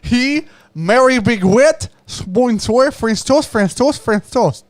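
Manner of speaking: exaggerated mock French accent, high and loud; hammed-up French accent. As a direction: go all out on a silly French accent. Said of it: "most French accent you could muster".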